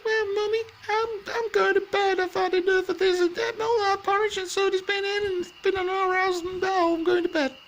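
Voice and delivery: very high squeaky little bear voice, sulking; a teased little cub whining to his mum; Baby Bear. Acted. Baby Bear complains to Mummy, very high whiny cub voice.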